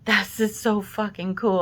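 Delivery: thrilled inner voice; excited. Retold or imitated. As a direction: blurt it out in wonder. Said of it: "And I was thinking".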